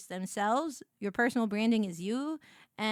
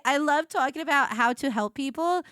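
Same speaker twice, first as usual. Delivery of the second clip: ordinary delivery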